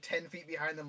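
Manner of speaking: ordinary talk, nothing marked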